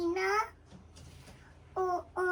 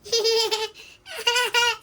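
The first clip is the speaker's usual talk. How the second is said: giggling; 4 semitones higher, 7 dB louder than usual. Real, not acted. Laughing during dinosaur play.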